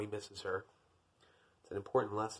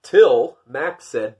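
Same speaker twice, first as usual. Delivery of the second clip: ordinary delivery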